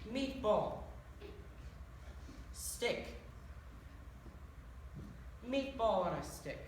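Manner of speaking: "Meatball", then "Stick", each alone after a long pause; slow word-by-word explanation, condescending; spelling it out. Shown as a demonstration. Leprechaun spelling it out for dim customers, word by word. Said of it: "spell it out for you".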